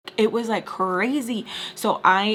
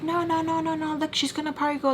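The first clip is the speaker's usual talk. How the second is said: protesting wife; 7 semitones higher than usual. Retold or imitated. Retold wife protesting in quarrel.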